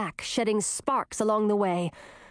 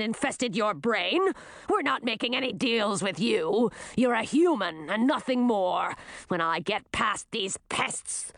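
scornful beast voice; snarling werewolf. A narrator's reading. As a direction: snarl with contempt like a defiant werewolf. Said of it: "the werewolf swatted at a lightning bug".

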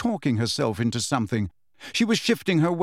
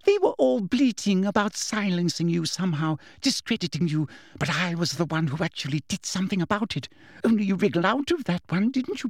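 Umbridge's voice; 5 semitones higher than usual. A narrator's reading. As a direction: gloat over Potter, then close in. Umbridge's menacing speech.

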